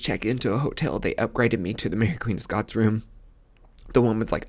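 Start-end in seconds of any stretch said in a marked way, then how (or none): none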